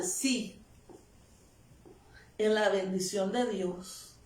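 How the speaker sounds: usual speaking voice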